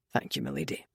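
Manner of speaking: grudging thanks under the breath; muttered thanks. Acted. Keir thanking Merritt, low quiet mutter.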